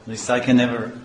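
normal talking voice

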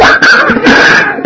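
high squealing laugh. Real, not acted. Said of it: "laughs at own imitation".

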